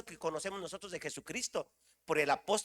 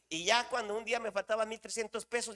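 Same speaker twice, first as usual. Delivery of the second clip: ordinary delivery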